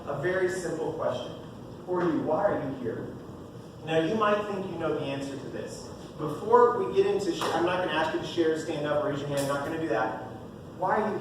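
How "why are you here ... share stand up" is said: normal talking voice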